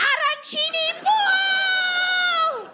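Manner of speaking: very high, very slow drawn-out wail; primal scream. Retold or imitated. A primal scream across Brunswick rooftops, high drawn-out wail. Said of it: "some sort of primal scream".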